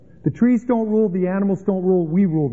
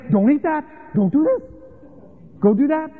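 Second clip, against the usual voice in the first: nagging parent; 7 semitones higher than usual. Retold or imitated. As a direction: fuss over them like a hovering parent. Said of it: "God did not follow them around saying".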